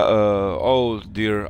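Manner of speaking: normal talking voice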